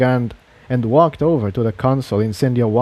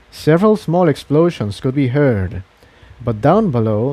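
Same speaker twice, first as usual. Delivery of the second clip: usual speaking voice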